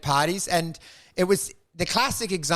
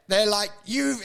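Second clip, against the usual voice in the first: ordinary talk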